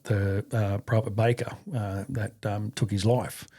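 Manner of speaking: normal talking voice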